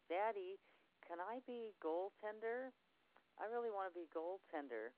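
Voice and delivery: put-on high, pleading child voice; little daughter's voice. Seven-year-old daughter begging her daddy to play goalie, in a high little-girl voice.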